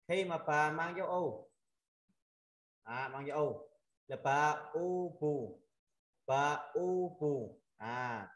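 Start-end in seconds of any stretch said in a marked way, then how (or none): 4.2-7.6 "pa U bù" very slow, louder oo held; syllable-by-syllable drill. Shown as a demonstration. Teacher showing students pa with mark U, slow drill. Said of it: "mình đọc là".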